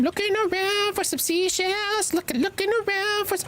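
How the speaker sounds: high, lilting singsong; sing-song voice. Acted. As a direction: sing the search like a tune. Sing-song while searching seashells.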